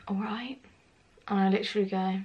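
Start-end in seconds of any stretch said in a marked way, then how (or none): 0.1-0.6 very soft, tentative retold greeting; subdued greeting. Friend sitting on her bed after a fight, subdued and quiet. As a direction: drop it right down, barely offered.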